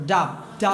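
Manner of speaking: "ডাব" cut off as a separate syllable; first syllable alone. Shown as a demonstration. Teacher breaks W down for students, "ডাব" on its own. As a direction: isolate "ডাব" as the first piece of W. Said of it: "প্রথম কি বলবো আমরা".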